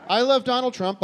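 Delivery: high, loud ironic declaration; mock gushing. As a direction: gush with heavy irony.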